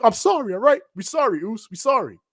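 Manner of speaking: Jey. Retold or imitated. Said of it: "He's like".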